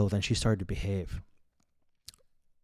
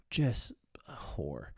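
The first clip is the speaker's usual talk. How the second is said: a cold, slow whispered aside; 5 dB quieter, much slower than usual. Real, not acted. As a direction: breathe it out slowly, no voice. Harsh aside about girlfriend.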